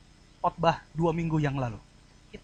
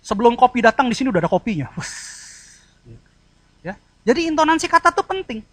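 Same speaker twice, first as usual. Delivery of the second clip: ordinary talk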